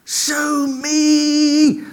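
high, strained plea called out to heaven; top-of-voice cry. Retold or imitated. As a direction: cry out to God, stretching the words. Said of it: "at the very top of his voice".

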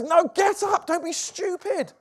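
loud, exasperated refusal; scolding. Retold or imitated. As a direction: cry out in acted disbelief. Acts someone refusing to be worshipped.

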